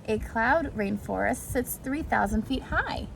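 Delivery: plain reading voice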